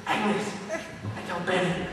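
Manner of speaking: very soft, secretive aside; acted stealthy lioness; furtive message. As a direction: pass it on under your breath. Lioness secretly signals her companions.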